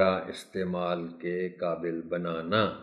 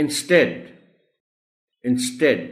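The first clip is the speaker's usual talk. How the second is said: "Instead" as in-STED, pitched high; stress on T; 4 semitones higher than usual. Shown as a demonstration. Teacher drilling stress, "instead" as in-STED. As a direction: hit the T syllable of "instead", twice. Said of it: "ٹی پر stress ہے".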